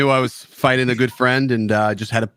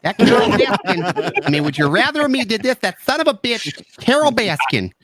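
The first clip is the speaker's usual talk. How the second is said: lightly camp up the Carol Baskin line; Tiger King voice; 8 semitones higher than usual. Acted. Fighter asking if he should mock Tiger King, hammed-up voice. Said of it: "talked like this".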